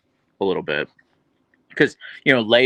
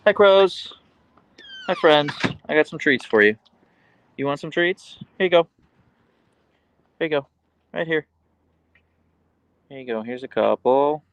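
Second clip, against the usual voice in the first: pet talk to crows; 7 semitones higher, 3 dB louder than usual. Real, not acted. Calling and feeding crows.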